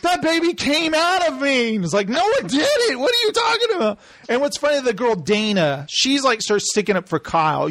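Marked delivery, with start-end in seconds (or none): 0.0-1.8 high-pitched indignant cry; hammed-up hysterical protest; mock outrage. Acts out baby fight. 2.1-4.0 very high, incredulous squawk; hammed-up indignant retort, rushing at the end; mock exasperation. Acts out quarrel reply.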